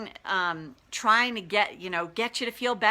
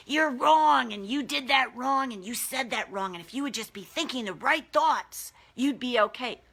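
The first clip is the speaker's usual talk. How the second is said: higher, taunting voice of the inner bully; mocking inner-critic voice, pitched up; demonic bully voice; 4 semitones higher than usual. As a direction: sneer it out like a demonic critic in her head. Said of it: "I use that voice 'cause that's how it sounds to me".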